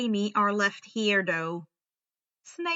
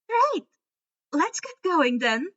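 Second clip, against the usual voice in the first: cheerful; 6 semitones higher, 5 dB louder than usual. A narrator's reading. Luna cheers.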